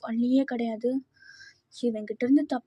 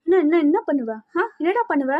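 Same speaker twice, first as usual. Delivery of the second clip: higher, louder repeated challenge; confrontational taunt; 3 semitones higher, 4 dB louder than usual. Acted. Quarreling pair, what will you do, taunting challenge. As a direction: dare them with rapid repeated questions.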